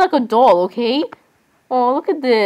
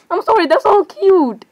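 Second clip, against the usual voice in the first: gushing; 8 semitones higher than usual. Real, not acted. Gushing over cute plush.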